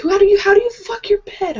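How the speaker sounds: high-pitched incredulous question; squeaky mock bewilderment; retold bafflement. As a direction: re-enact being utterly stumped, voice way up. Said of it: "I didn't get it".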